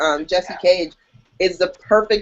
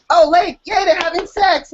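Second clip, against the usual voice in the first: mock cheer; 10 semitones higher than usual. Mocking the plot to her co-host with high sarcastic cheer. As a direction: fake delight at a shallow plot.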